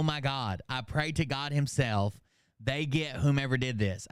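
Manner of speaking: ordinary talk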